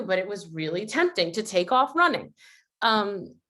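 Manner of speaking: ordinary talk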